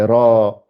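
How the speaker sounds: "ra" with a long held a; lengthened vowel. Shown as a demonstration. Teacher showing what alif does, "ra" drawn out long. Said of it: "Elle allonge la précédente".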